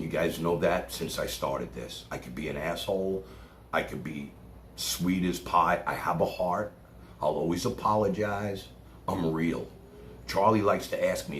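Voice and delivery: ordinary delivery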